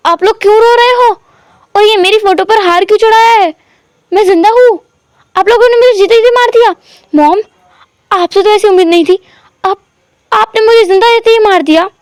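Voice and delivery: high, anguished disbelief at his own funeral; upset protest. A narrator's reading. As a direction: cry out that you are alive. Wing protests he is alive.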